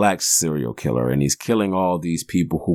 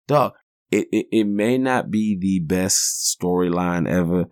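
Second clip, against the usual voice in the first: normal talking voice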